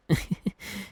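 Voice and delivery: a short airy laugh under the breath. Acted. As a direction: let a shy little laugh slip out. Nervous moment before kiss.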